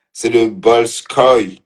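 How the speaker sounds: Bolshoi said slowly, each syllable spelled out; careful pronunciation. Real, not acted. Correcting her earlier slip, slow careful Bolshoi. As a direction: slowly sound out Bolshoi to get it right. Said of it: "je ne prononçais pas bien".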